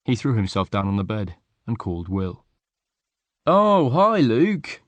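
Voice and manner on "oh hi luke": higher, louder bright hello; light up when the friend calls; cheerful greeting. A narrator's reading. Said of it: "Will said cheerfully".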